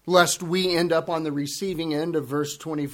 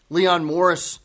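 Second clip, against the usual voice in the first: ordinary talk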